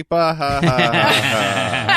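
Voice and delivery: slow, loud evil cackle; mustache twirling villain laugh. Retold or imitated. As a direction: gloat like a cartoon villain. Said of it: "My comedian stepbrother made another silly".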